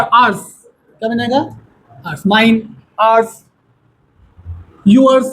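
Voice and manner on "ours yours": ordinary delivery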